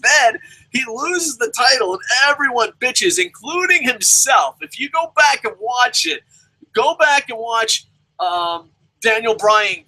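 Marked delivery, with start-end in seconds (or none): none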